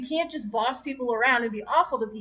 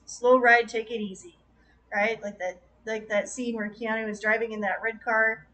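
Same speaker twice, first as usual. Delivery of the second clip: ordinary talk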